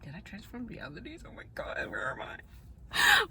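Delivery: breathy, hushed disbelief, slowing at the end; dazed whisper. Retold or imitated. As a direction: whisper it like you just woke up in another world. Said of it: "and I was like".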